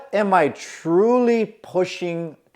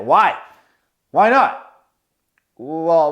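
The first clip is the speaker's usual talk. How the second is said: aggressive demand; 5 dB louder, slower than usual. Retold or imitated. Manager goes at him.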